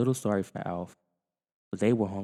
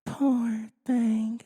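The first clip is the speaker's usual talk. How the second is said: Kate sighing. Acted. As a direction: sigh in pity. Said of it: "she said".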